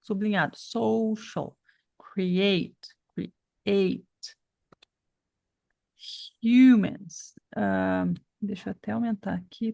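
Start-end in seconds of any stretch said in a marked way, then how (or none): 0.6-1.6 "Social" with SO stressed, falling; stressed first syllable. Shown as a demonstration. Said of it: "Vamos colocar aqui sublinhado". 6.4-7.3 "Humans" louder, HU punched; stress on first syllable. Shown as a demonstration. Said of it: "ainda tem a sílaba tônica".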